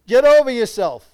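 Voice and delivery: \"Get over yourself\" barked high and loud. Shown as a demonstration. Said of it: "Now tell yourself".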